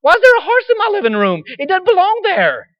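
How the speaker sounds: high, loud disbelief; a husband outraged at a horse in his living room; shocked homeowner. Retold or imitated. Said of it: "you go like".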